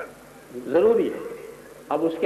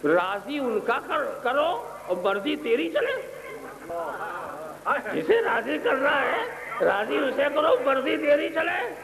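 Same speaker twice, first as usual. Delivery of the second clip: slow, raised preaching cadence; 8 semitones higher, slower than usual. Acted. Preacher to congregation, slow and raised. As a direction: drive the maxim home slowly.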